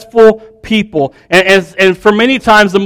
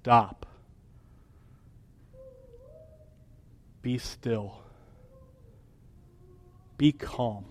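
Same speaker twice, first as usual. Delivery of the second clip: very soft, much lower, slow commands with long pauses; Jesus calming the storm; 6 semitones lower, 24 dB quieter, slower than usual. Retold or imitated. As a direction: hush the storm with calm authority.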